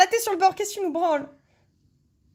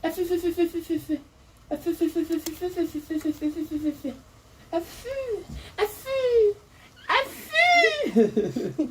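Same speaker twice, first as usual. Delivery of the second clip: chanting; 4 semitones higher, 4 dB quieter, slower than usual. Real, not acted. Chanted hot-hot cries.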